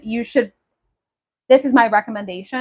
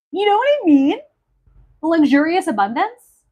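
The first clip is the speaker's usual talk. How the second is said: high, eager rising question; gleeful much-higher ask; delighted; 6 semitones higher than usual. Acted. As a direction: sell it with a thrilled rise.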